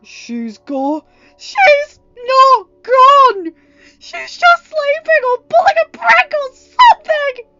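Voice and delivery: high, loud, slow sobbing scream; crying denial shout. Acted. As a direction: scream it isn't true through tears. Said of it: "Ryan shouted".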